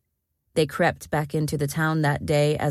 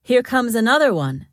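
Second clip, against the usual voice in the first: higher-pitched, mild call-out; lightly raised narrated shout; 6 semitones higher, 4 dB louder than usual. A narrator's reading. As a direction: announce it as a lookout calling down. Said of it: "He shouted down".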